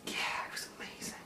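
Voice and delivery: unvoiced, very soft whisper; whispered aside. Real, not acted. Remembering the year in France, whispered aside. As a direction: whisper it quickly to yourself. Private aside mid-story.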